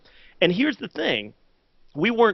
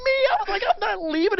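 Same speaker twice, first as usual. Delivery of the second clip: much higher, half-crying shout of disbelief; incredulous outcry; faster than usual. Retold or imitated. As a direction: howl it out like you can't believe her. Husband's disbelief at wife's idea.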